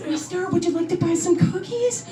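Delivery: put-on little girl voice. Little girl selling cookies at door.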